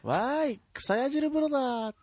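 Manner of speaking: put-on high cute anime girl. Acting Harada's beaming one-liner.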